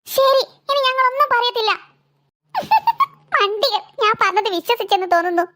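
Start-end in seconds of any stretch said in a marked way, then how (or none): 2.5-3.1 short high-pitched teasing giggle; high mocking laugh. The girl laughs after fooling her friends, high and mocking. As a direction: laugh up high at the fooled boy.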